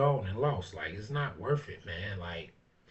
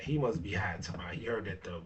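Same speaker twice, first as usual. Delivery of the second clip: whispered aside; 4 semitones lower, 6 dB quieter, faster than usual. Real, not acted. Muttered aside in disbelief.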